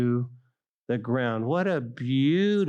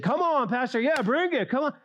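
high, excited cheering from the pews; cheerleader shout; 11 semitones higher than usual. Retold or imitated. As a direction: cheer the pastor on like a fan. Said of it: "my wife will say, "Oh, God brought you a little cheerleader".

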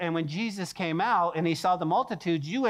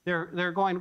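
ordinary talk, nothing marked

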